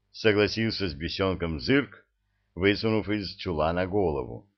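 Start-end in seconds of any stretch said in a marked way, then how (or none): none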